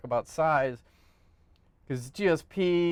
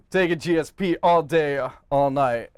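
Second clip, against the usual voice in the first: ordinary delivery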